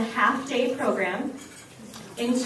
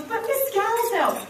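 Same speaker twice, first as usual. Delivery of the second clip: lightly voiced boy, high and drawn out; slow whiny little boy; 9 semitones higher, slower than usual. Little boy pleads with his teacher, high and slow. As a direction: plead like a small kid who won't give up. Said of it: "and he goes".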